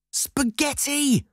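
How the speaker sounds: very high, drawn-out call; high-pitched, stretched cry without much volume; excited mock yell. A narrator's reading. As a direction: call out the answer at the screen. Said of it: "I yelled at the screen".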